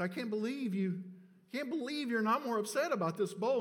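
hammed-up hurt husband; indignant outburst. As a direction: scold her for not caring, pitch up. Acted quarrel over bowl.

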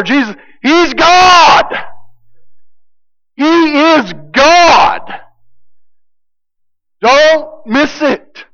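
high, slow, forceful proclamation; emphatic preaching. Acted. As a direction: drive each word home slowly from the pulpit. Preacher hammering home John's emphatic point.

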